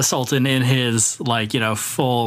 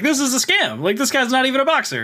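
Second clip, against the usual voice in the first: high-pitched mock protest; imitated heckling reporter; 10 semitones higher than usual. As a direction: call out like a sceptic in the crowd. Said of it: "that one guy stands up".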